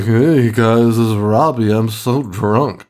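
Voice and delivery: slurry, stretched-out drunk imitation; drunk vlogger. Robbie vlogging outside for his viewers, drunkenly. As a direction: mimic a drunk friend drawling into a camera. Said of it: "your drunk high school friends".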